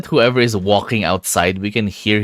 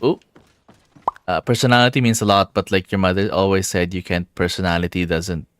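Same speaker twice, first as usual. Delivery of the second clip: ordinary talk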